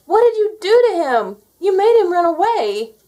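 very high, loud, desperate shout; anguished cry. Acted. Terry crying out at his parents, desperate and loud. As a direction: scream it in panic. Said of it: "cried out".

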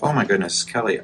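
normal talking voice

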